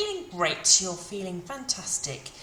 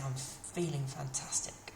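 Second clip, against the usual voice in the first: whisper; 5 semitones lower, 8 dB quieter than usual. Shown as a demonstration. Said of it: "whispered prompt".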